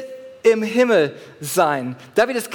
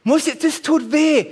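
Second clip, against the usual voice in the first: a touch of complaining reluctance; mock reluctant whine; 5 semitones higher than usual. Preacher acting his reluctance before a set, mild whine. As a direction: whine like you don't want to lift. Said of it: "jedes Mal ist vor die Frage".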